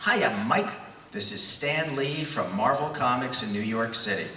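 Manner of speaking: ordinary delivery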